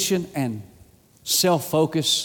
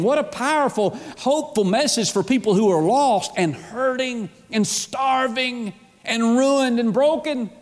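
higher-pitched, driving sermon delivery; impassioned preaching; 6 semitones higher than usual. Real, not acted. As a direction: preach it with rising fervour, pressing through the list. Preaches at full force.

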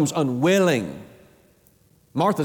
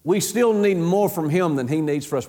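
ordinary talk, nothing marked

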